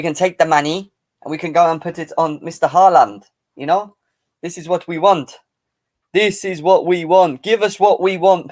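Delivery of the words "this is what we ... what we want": mock Chelsea board demand. Chelsea board voiced demanding the fans' money, loud mock chant. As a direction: hammer it home like a greedy club board. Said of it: "Chelsea board, I'm talking about".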